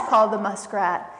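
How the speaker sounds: usual speaking voice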